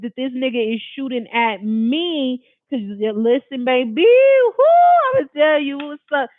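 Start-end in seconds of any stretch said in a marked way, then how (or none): 3.6-6.3 high, loud, drawn-out whoo then rushed words; overwhelmed whoo. Real, not acted. Recalling being shot at, high loud overwhelmed whoo. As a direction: let the emotion burst out. Emotional peak recounting shooting.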